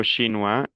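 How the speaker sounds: "chinois" ending on an open wa; silent final S. Shown as a demonstration. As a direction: leave the s off "chinois". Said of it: "las S finales no se pronuncian". ("chinois" terminado en wa, sin la s)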